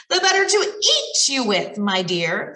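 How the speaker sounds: bare the teeth and snarl it. Acted. Wolf reveals himself to the girl with a snarl. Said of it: "snarled the wolf".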